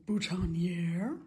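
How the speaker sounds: boutonniere tried slowly in a shaky American accent; a self-mocking stab at an American boutonniere; attempted American accent. Shown as a demonstration. Florist jokingly tries the American word for buttonhole, in a bad American accent. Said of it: "I can't do an American accent".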